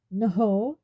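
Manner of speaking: a sharp, loud indignant no. A narrator's reading. Harry denying to Ron, loud and indignant. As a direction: bristle at the accusation. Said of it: "said Harry indignantly".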